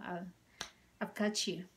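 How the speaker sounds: normal talking voice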